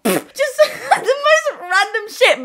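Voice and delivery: words squeezed out through laughter, high and slow; cracking up mid-sentence; laughing. Real, not acted. Laughing to her viewers about silly alien stories.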